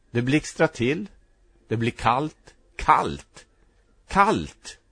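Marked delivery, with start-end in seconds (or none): 2.8-4.8 rising cry of cold. A narrator's reading. Newborn feels cold, repeats.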